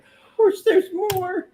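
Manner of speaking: knowing singsong; high teasing. Real, not acted. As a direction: tease it up high. Teasing, knowing there's more.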